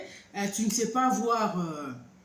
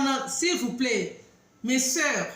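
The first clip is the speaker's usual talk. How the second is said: ordinary delivery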